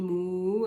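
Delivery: long drawn-out moo; cow's moo, stretched out. Shown as a demonstration. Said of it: "es un mugido".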